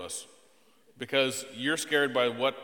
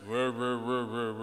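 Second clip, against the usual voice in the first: low monotone wordless drone; droning "r-r-r" noise; 3 semitones lower than usual. Shown as a demonstration. Dad mimicking the unhappy tone, droning noise. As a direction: imitate a lifeless voice as mumbled syllables. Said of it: "really sound happy".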